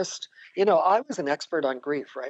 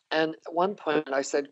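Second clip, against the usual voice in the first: usual speaking voice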